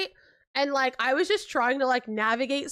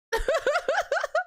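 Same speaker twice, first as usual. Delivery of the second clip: high-pitched burst of giggling; real laugh; 14 semitones higher than usual. Real, not acted. Laughs about her friend's packing.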